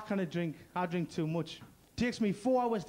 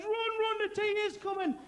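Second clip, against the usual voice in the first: mock panic; 10 semitones higher than usual. Afghans voiced.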